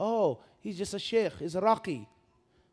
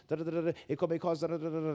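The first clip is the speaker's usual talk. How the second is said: fast mumbled disclaimer; rushed mumble; 2 semitones lower, 4 dB quieter, much faster than usual. Retold or imitated. Ad disclaimer read at top speed, mumbled fast. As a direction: gabble it like the small print. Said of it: "They, they rush through it".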